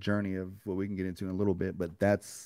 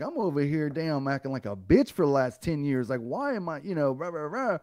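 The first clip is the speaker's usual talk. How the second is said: self-scolding rant; 7 semitones higher than usual. Retold or imitated. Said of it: "I was like".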